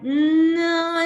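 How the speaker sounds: long, loud, high-pitched no; emphatic self-correction. Retold or imitated. Telling herself kiwi is out, drawn-out emphatic no. Acts out correcting her own thought.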